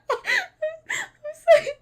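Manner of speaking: high, loud, laughing and teary; laughing apology. Real, not acted. Player apologizing for her voices, laughing. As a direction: apologize while still laughing. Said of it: "my need to do useless voices".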